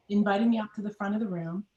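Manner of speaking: ordinary talk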